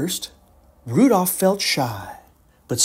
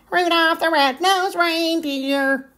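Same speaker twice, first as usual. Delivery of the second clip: much higher, slow taunting singsong; mock him like schoolyard bullies; jeering; 13 semitones higher than usual. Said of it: "they jeered".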